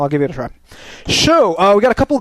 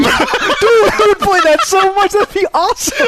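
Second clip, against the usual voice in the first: high, slow, through laughter; laughing excitedly; 12 semitones higher, slower than usual. Real, not acted. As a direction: gush while laughing. Laughing excitement over idea.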